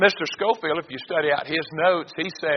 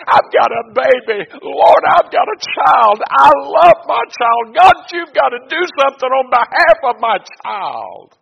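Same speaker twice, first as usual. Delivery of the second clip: loud, high, wailing plea of a mother; crying out; 10 semitones higher, 12 dB louder than usual. Acted. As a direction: cry out to God at full voice, drawing the words out. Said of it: "But she's crying".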